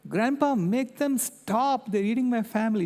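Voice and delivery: imitated bawling plea. Granddaughter begging grandpa to stop it, in a mock tearful child voice. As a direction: plead like a crying kid, imitated. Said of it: "She started bawling".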